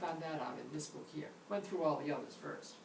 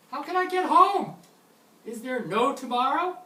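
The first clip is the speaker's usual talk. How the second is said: much higher, loud, mock-desperate plea; panicked cry; 8 semitones higher, 14 dB louder than usual. A learner cornered by grammar, mock-panicked and loud. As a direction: wail like someone stranded with no way home.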